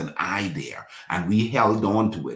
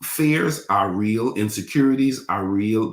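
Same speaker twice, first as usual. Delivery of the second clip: ordinary delivery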